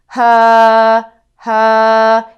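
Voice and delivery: loud controlled ha from the diaphragm; powerful ha. Shown as a demonstration. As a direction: belt a strong ha from the belly. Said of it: "this tone isn't controlled and powerful".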